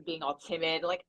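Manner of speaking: ordinary delivery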